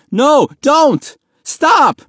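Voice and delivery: loud, very high pleading shout; desperate begging. A narrator's reading. Boy begging soldiers to stop beating his father, desperate. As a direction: beg them frantically to stop. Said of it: "Mahmoud begged".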